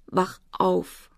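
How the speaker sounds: hushed, broken entreaty; quiet, weeping plea; tearful pleading. A narrator's reading. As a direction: beg weakly through tears. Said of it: "flehte er weinend".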